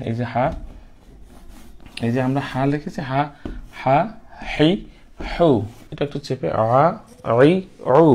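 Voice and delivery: usual speaking voice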